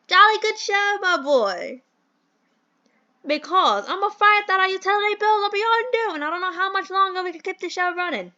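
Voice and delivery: high, hammed-up British gent; bad British accent. Mentor frets over overdue bills, hammed-up British accent. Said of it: "do the worst British accent".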